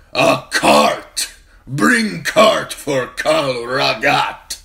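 mock the beggar king for the crowd; mocking shout. A narrator's reading. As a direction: khal jeering at the drunk prince. Khal mocks prince before hall.

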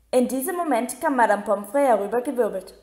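ordinary delivery, nothing marked

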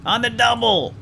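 hammed-up officer yelling at Smith; mock drill order. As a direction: shout it like an impatient sergeant. Barking orders at crew member.

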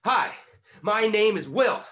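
high, loud, each word landed like a jab; punched words. Shown as a demonstration. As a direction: punch out the introduction slowly. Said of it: "connecting our punches with our words".

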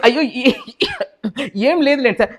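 trying not to laugh while answering; stifled laughter. Acted. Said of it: "ఎందుకు నవ్వుతున్నావు నువ్వు?".

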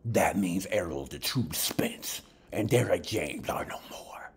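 slow mock-dramatic pundit voice fading to a whisper. Lightly mocking the YouTuber's claim, slow, then whispered. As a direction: lightly imitate a hushed conspiracy reveal.

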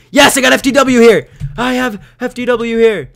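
high, loud announcement; excited shout. Real, not acted. Player glad an ally arrived, excited shout. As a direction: shout it in triumph.

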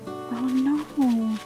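normal talking voice

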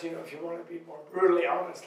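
ordinary talk

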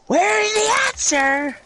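dramatic question. Acted.

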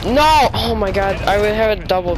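sudden high yell of dismay; frustrated shout. Real, not acted. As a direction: shout it out in protest. Missed a double kill.

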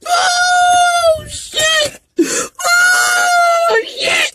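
real crying, wailing very high; wailing sobs. Real, not acted. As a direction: let it break into a raw wail. Wails after telling life story.

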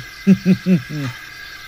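boastful staged laugh; smug laugh. Acted. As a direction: laugh at your own jibe. Boaster laughs at his own jibe.

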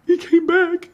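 high, slow tearful squeal; loud mock sobbing voice; imitated tearful cry. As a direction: squeak it out like someone crying.

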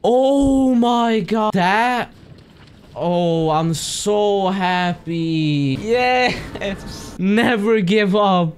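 high, slow, stunned joy; beaming with disbelief; overjoyed. Real, not acted. Overjoyed after the win.

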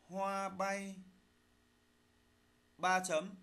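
slow dictation, phrase by phrase; dictating. Shown as a demonstration. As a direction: read it out slowly for pupils to write. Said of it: "lắng nghe thầy đọc bài, chúng ta soát lỗi".

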